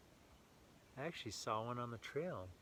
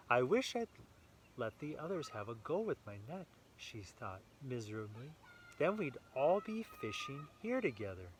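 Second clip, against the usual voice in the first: lively read-aloud storytelling voice; story narration; 5 dB louder than usual. A narrator's reading. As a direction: read it brightly to a small child. Reading picture book to child.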